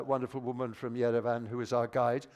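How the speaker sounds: ordinary talk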